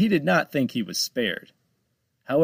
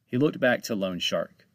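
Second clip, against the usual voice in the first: plain reading voice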